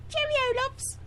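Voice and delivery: high British cheerio; playful British farewell; chirpy British goodbye; British accent. Acted. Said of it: "she's got the accent down to a T".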